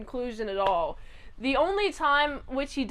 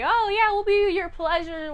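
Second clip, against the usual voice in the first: fawning women; 7 semitones higher, 4 dB louder than usual. Retold or imitated. Voices the women fawning on him.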